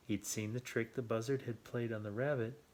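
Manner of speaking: ordinary delivery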